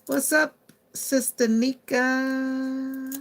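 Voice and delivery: high, loud, long drawn-out "Sister Nika"; excited greeting. Real, not acted. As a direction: sing out a hello to a fan. Greeting a viewer in the live.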